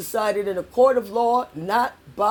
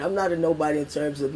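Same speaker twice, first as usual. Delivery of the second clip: usual speaking voice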